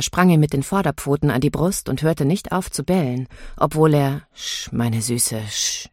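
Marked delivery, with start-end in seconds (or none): 4.3-5.9 low, slow, soft shushing; soft, drawn-out calming murmur; soothing hush. A narrator's reading. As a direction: hush a dog tenderly and quietly. Man hushes his barking dog.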